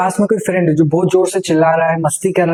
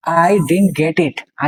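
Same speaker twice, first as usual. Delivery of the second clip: "I didn't get it" spaced out very slowly, word by word; teacher spelling out "I didn't get it" slowly for the class; slow model; much slower than usual. Shown as a demonstration.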